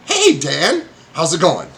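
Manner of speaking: high, bright call to a friend; mayor greeting Dan; cheery greeting. Acted. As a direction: wave him over warmly. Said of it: "He's like".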